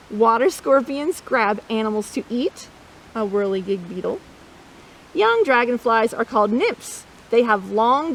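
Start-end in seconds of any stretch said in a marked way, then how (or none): none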